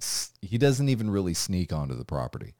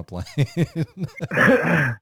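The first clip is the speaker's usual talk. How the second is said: drawn-out; 9 semitones higher, 7 dB louder than usual. Acted. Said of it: "film title spoken slowly".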